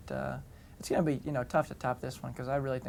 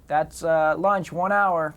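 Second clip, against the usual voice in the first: called out; 4 semitones higher, 12 dB louder, much slower than usual. Retold or imitated. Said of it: "he has the first director go".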